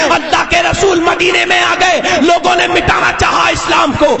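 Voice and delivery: steady, projected sermon narration; insistent pulpit delivery; forceful preaching. Real, not acted. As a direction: tell it loudly to a whole congregation.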